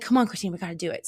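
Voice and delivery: ordinary talk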